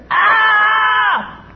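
put-on sudden shrill scream as the child falls; child's scream of pain. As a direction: cry out like a hurt child. Child hurt in the fall.

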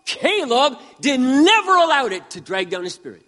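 ordinary talk, nothing marked

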